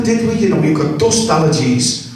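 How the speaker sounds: ordinary delivery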